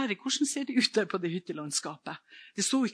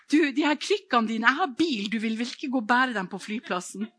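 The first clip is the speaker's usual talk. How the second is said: higher and louder, eager; bright, brisk offer of help; lively retelling; 6 semitones higher, 8 dB louder than usual. Retold or imitated. A lady at the airport offers to take the crutches, eager.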